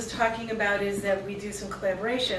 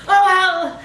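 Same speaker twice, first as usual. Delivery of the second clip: louder, higher gleeful shout; screamed exclamation; 8 semitones higher, 8 dB louder than usual. Acted. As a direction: let it burst out as the climax. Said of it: "I'm screaming".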